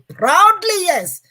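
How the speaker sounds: loud, high, proud "Proudly, yes"; proudly claiming the accent; proud reply. Retold or imitated. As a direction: answer with loud, high pride. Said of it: "I proudly told her".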